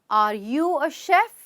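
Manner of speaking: very slow question, "chef" lifting; rising question tone. Shown as a demonstration. Teacher giving practice examples, tone rising on "chef". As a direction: draw it out and rise on "chef". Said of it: "चलिए कुछ और examples देखते हैं।".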